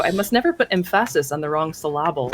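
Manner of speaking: "emphasis" as em-FASS-is, "syllable" as suh-LAB-le; wrong-syllable stress. Retold or imitated. Player quoting a friend's joke, "emphasis" and "syllable" stressed on second syllables. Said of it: "the emphasis, and I had a friend who".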